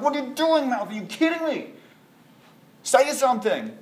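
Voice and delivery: high squeaky alarmed chorus; a tiny frantic voice begging the mouth to speak; panicked body parts. Acted. The body parts pleading with the mouth, high and panicky.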